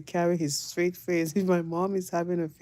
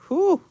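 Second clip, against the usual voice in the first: loud, high whistle of a whew; 13 semitones higher, 8 dB louder than usual. Real, not acted. Reacting to the tough sister with a loud whew. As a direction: blow out an impressed whew.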